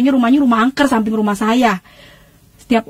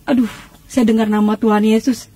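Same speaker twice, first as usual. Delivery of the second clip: usual speaking voice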